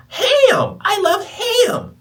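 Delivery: put-on very high, loud, slow squeal of a child; Ponyo voice. Imitating Ponyo's voice for the listeners, high squeal. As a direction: squeal it like an excited little girl. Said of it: "if you hear her voice".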